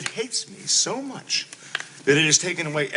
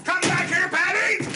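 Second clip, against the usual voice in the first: shouting after her; 11 semitones higher than usual. Acted. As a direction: yell after her as she runs. Husband calling after fleeing wife.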